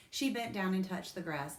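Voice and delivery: plain reading voice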